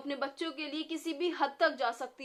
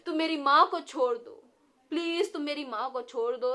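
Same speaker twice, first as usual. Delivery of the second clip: loud desperate begging; pleading; 2 semitones higher, 8 dB louder than usual. A narrator's reading. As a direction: beg for his mother's life. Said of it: "गिड़गिड़ाने लगा".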